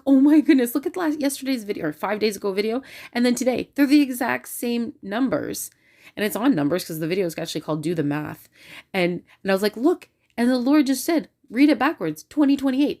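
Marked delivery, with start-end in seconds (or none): 0.0-5.7 high, excited retelling to her husband; eagerly showing off an amazing discovery; all excited. Retold or imitated. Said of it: "I'm all excited". 9.8-13.0 high, insistent retelling; excitedly reporting a revelation; talkative excitement. Retold or imitated. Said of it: "see how I'm all talkative".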